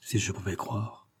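very soft, calmed murmur. A narrator's reading. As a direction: murmur it as a faint hope. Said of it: "murmura-t-il, soudainement calmé". (murmure apaisé)

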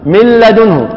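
من لدنه read as مِلَّدُنْهُ; nun merged into lam. Shown as a demonstration. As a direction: double the lam, swallow the nun of من. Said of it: "نحول النون إلى لام".

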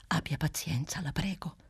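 hushed, breathy whisper of apology. A narrator's reading. Clelia begs Bruno's patience in a whisper. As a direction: whisper it so nobody in the house hears. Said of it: "bisbigliava nel mentre Clelia Trotti".

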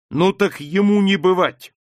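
high, loud, firm refusal; defiant refusal. A narrator's reading. Margarita refusing the marriage, defiant. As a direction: put your foot down, pitched high. Daughter defies the arranged marriage.

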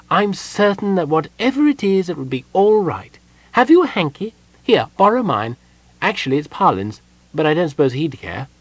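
high-pitched kender voice, reassuring; chatty, kindly kender. A narrator's reading. Kender offering the weeping gnome a hanky, reassuring.

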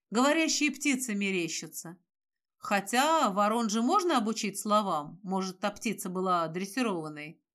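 ordinary delivery, nothing marked

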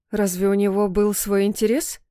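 plain reading voice

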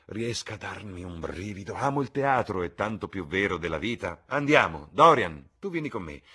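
high, loud lord calling them along; enthusiastic Lord Henry. Acted. Lord summons Dorian to the theatre, loud and eager. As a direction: build to a rousing call, then ease.

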